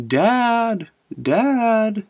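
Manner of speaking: high, drawn-out imitation of a child calling; half cry, half yell, mock child voice; crying child's call. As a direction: mimic a kid wailing for Dad at night. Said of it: "kind of a cry, kind of a yell".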